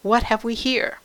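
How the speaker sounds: stern woman eyeing an unwelcome arrival; disgusted. A narrator's reading. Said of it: "the woman said with disgust".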